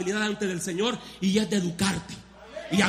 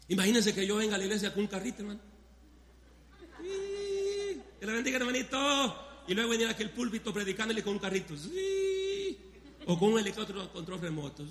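amused quick aside; 7 dB quieter, faster than usual. Real, not acted. Joking quiet fast aside.

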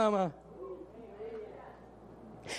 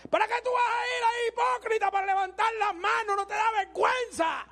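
higher, fast mocking sneer; devil's taunt; 5 semitones higher than usual. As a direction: taunt him for raising his hands. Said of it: "Te dice". (el diablo se burla, agudo y rápido)

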